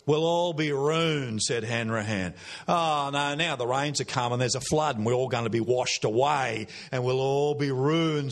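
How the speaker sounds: doom-laden Aussie complainer, stretching out the wail of "ruined"; Hanrahan. Acted. Preacher summarising an Australian poem, complaining recital. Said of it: "you get the complaining kind of approach".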